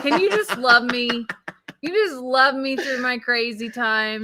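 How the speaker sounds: pleading plea. Retold or imitated. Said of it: "I'm like".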